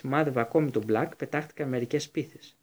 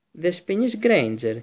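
curt; 5 semitones higher, 5 dB louder than usual. A narrator's reading. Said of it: "της είπε κοφτά ο Σνέιπ".

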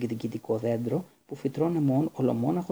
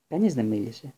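plain reading voice

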